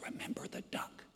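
whispered mock blackmail; menacing whisper. Sister whispering a reminder to her brother, menacing. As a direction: drop to a whisper, a secret threat. Sister's secret threat, unvoiced.

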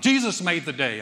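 loud, pushing the words at me; imitating mom hammering it home; mom's loud insistence. Mother to her college-age son on a walk, loud and insistent. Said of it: "My mom's like".

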